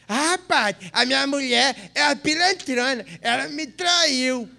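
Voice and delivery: high, put-on husband's lament; lightly voiced complaining husband. Husband complains to the priest about his wife, lightly mock-aggrieved.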